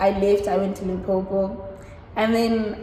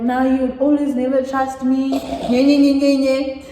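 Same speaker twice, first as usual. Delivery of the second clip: louder, higher nye-nye mimicry; mock his excuses in a raised sing-song; mocking whine; 3 semitones higher, 4 dB louder than usual. Girlfriend mimicking her boyfriend's denial to friends, mocking.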